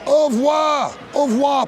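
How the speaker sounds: high, loud exaggerated French farewell; hammed-up French. Brit parodies French goodbye to Pierre, loud and high. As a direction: call it out like a flamboyant Frenchman. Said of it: "The French".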